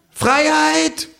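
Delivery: high, loud proclaiming cry; kangaroo calling out. Acted. Kangaroo on the counter, calling out to the pub. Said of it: "ruft das Känguru".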